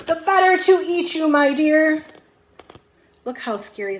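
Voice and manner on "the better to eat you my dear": loud, high, booming threat; big bad wolf roar. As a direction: pounce on the words. Wolf's climactic reveal, shouted.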